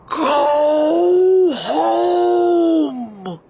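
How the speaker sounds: howling wind voice, high and loud; squalling wind. A narrator's reading. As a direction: squall it like a gale. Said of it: "the wind squalled".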